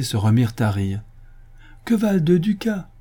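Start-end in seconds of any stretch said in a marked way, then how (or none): 1.8-2.9 high-pitched indignant question; scornful exclamation. A narrator's reading. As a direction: exclaim it as a dismissal. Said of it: "s'écria le chancelier". (exclamation méprisante, voix aiguë)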